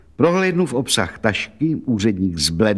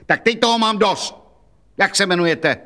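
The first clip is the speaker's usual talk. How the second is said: put-on much higher, fed-up shout at the old woman; exasperated outburst; 8 semitones higher than usual. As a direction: lose patience and bark the question. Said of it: "vykřikl".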